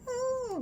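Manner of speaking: high, thin little oh sigh; a feeble sigh kept up in the head; wimpy sigh. Shown as a demonstration. Therapist showing the wrong sigh, high wimpy oh. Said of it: "little wimpy sigh".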